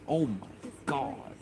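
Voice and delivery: high squeaky mock "Oh my God" dissolving into a laugh; imitating Hawaii. Streamer mimics a chat friend's catchphrase, high and laughing. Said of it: "I cannot say, "Oh my God," without thinking of Hawaii".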